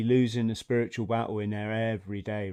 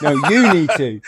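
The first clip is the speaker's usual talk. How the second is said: louder, bursting with laughter; loud laughing retort; 11 dB louder than usual. Real, not acted. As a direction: throw it back loud through a laugh. Friends playfully throwing words back.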